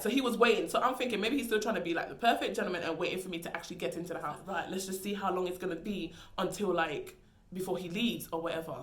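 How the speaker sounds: ordinary talk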